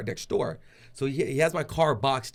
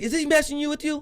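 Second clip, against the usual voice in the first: alarmed pastor. Retold or imitated.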